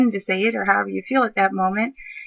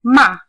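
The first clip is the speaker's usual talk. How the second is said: fourth-tone "ma", a quick fall; 3 semitones higher, 9 dB louder than usual. Shown as a demonstration. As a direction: drop "ma" sharply from the top. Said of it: "fourth tone with the exact same pinyin".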